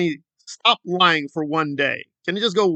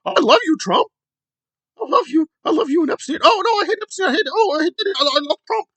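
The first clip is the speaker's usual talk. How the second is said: much higher, gushing mock fan voice; mock Trump fan; 10 semitones higher than usual. Mocking Trump fans torn over Epstein, much higher gushing mock voice. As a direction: gush like a confused devotee. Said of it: "They're like".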